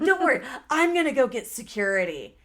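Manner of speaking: emphatic announcement. Retold or imitated. Telling the group she'll fetch security, emphatic. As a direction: announce it defiantly to the whole group. Retold announcement during the quarrel.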